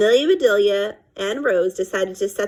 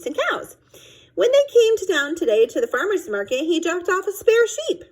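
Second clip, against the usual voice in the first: put-on child explaining; 6 semitones higher than usual. A narrator's reading. Child sheepishly explains to parents.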